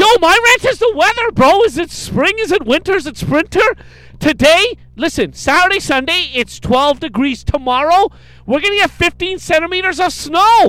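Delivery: high, loud comic tirade about the weather; yelled rant. Pretend. Co-host launches his weather rant to the podcast, yelling. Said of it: "thank you for yelling".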